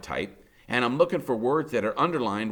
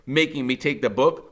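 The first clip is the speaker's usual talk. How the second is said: ordinary delivery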